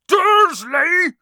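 huge high bellow of the name; booming shout. A narrator's reading. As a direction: roar it with full force. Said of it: "he boomed".

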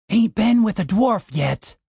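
put-on leering drunk.

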